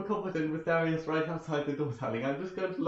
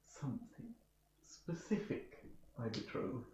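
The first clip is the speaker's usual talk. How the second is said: hushed secretive whisper; 3 semitones lower, 15 dB quieter than usual. Acted. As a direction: breathe it through the keyhole. Player speaks softly through door.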